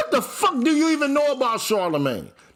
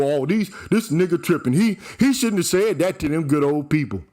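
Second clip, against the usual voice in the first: lower, mock-prim tut-tutting; mocking critic voice; 5 semitones lower, faster than usual. Mocking the critics who scold him, in a lower mock-prim voice. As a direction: drop low and play a clucking scold.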